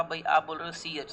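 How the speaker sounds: normal talking voice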